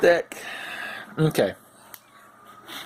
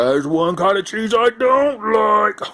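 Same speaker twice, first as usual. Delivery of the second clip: higher, slow put-on voice; dopey outlander; 8 semitones higher than usual. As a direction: deliver the joke dead proud.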